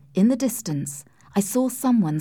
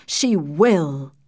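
forceful, emphatic raised voice; insistent shout; 3 semitones lower, 3 dB louder than usual. A narrator's reading. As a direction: insist firmly, as if overruling all objection. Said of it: "shouted Sir Percival".

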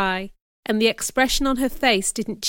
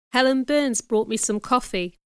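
plain reading voice

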